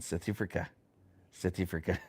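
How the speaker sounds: softly imitated South African accent. Imitating the oldest boy when little, mock South African accent. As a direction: mimic a child trying a South African accent. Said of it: "trying to speak in a South African accent".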